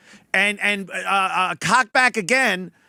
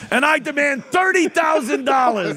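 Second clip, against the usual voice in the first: mock demand; 6 semitones higher, 3 dB louder than usual. Mocking John's demand.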